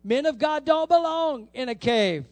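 high, loud admonition; preacher's rebuke. Acted. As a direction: drive the rebuke home loudly. Preacher voices rebuke implied by question.

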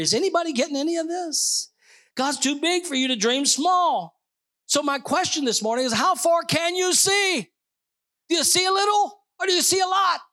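high, rousing pulpit call; full-force preaching. Real, not acted. Pastor asking the church how far they can see, high and fervent. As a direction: drive the questions up to a high, ringing peak. Preacher's climactic challenge to congregation.

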